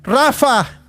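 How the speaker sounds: high ringing call of names from the sky; angel calling from heaven. Acted. Angel shouting names from heaven to the listeners. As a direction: call each name out like a voice from heaven. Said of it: "el ángel del Señor gritó".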